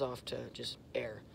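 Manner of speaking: goes off into just air; breathy air. Shown as a demonstration. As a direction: let the end trail into unvoiced air. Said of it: "a little bit in front of it".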